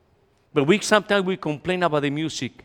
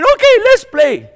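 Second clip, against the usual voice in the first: high, slow playful invite; playful Jesus; 14 semitones higher, 11 dB louder, slower than usual. Retold or imitated. Preacher voices Jesus inviting the children to play. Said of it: "Jesus, he was going".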